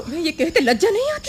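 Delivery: high, wounded indignation; indignant rebuke. Acted. Rebukes him for doubting her.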